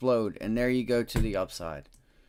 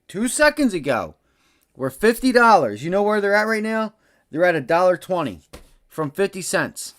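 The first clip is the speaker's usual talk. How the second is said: loud, higher, hyped-up rush; excited shouting; 5 semitones higher, 5 dB louder than usual. Real, not acted. Trader hyping viewers about the calls' gain, excited shouting. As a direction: pump up the viewers with the numbers.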